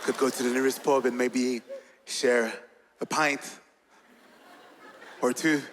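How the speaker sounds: British-accented flirting with lingering pauses. Shown as a demonstration. Inviting a stranger to the pub, smooth British accent. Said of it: "si je change juste un peu mon accent".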